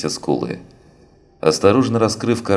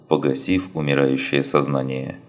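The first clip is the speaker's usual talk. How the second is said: usual speaking voice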